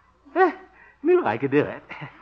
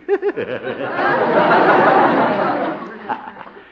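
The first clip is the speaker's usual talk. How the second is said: loud rolling laugh, pleased with himself; booming self-satisfied laugh; hearty laugh; 7 semitones higher, 7 dB louder than usual. Acted. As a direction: laugh long at your own joke. Laughs at his own joke.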